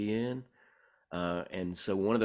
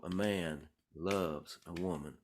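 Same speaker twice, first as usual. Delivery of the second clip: "A man ... loves ... a woman", slow and spaced out; different rhythmic feel; 3 dB quieter, slower than usual. Retold or imitated. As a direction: stretch it out, pausing after "man" and after "loves". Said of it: "with different kinds of feels".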